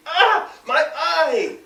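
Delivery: high, slow cry of pain; pained scream. A narrator's reading. Son hit in the eye by juice, high pained cry. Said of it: "he screamed".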